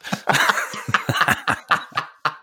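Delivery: long, helpless burst of laughter. Real, not acted. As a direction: crack up at your own teasing. Friend teased about bodily habits.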